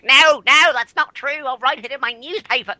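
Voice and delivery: high put-on voice of indignant denial; high-pitched caricature, loud on the first words; Willow. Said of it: "once you do Willow voice".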